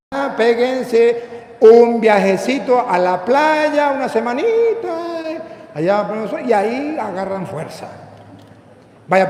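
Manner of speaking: slow, stretched-out coaxing; mock invitation. Priest parodies Jesus sending tired disciples partying, mock coaxing. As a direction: draw the words out, tempting them to take it easy.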